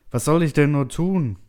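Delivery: high, loud, helpless question; desperate cry. A narrator's reading. Helpless settler asks silent computer for guidance, desperate. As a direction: wail it out, at a loss.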